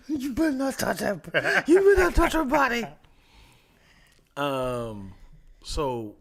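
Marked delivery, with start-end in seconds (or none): 0.1-2.8 high, loud laughing threat; laughing warning. Retold or imitated. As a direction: warn through laughter. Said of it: "In my head, I'm like".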